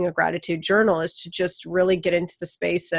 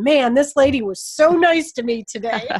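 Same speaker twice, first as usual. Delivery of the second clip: grateful customer; 7 semitones higher, 4 dB louder than usual. Retold or imitated. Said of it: "putting in".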